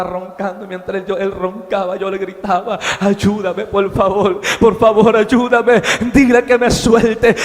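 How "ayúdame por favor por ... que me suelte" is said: acted cry for help, growing louder; yelled plea for help. Man calling his sleeping companion for help, yelled plea. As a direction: beg for help, building to a mild yell. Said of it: "yo empecé a gritarle a mi compañero".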